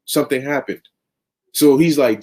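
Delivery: ordinary talk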